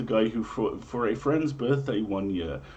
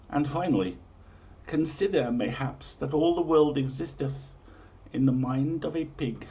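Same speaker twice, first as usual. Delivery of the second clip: grand, raised olde-English storyteller read; dramatic prophecy reading; 4 semitones higher than usual. Acted. Host reading medieval omens to listeners, grand storyteller voice. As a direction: proclaim each omen like a town crier.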